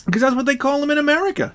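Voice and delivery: high squeaky cartoon monkey; cereal mascot voice. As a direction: shout it like Coco the Monkey. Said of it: "talked like that".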